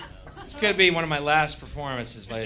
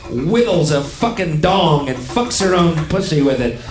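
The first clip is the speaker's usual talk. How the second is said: loud, higher-pitched tirade; angry rant; 5 semitones higher, 6 dB louder than usual. Pretend. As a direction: lay into them, punching the swear words. Hostile rant at redneck.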